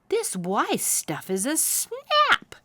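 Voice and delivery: high, slow smug boast; young owl boasting on the way home; cocky. A narrator's reading. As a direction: brag about it lightly.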